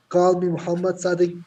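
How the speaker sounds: usual speaking voice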